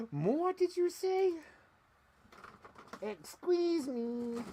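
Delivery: very high, slow offended voice; mock indignant gasp. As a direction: pretend to be scandalized.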